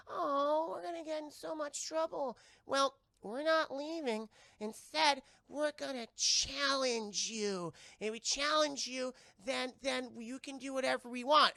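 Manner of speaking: high, slow cartoon creature voice; small alien fretting, then issuing a challenge; little monsters.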